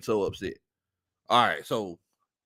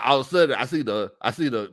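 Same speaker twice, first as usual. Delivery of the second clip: usual speaking voice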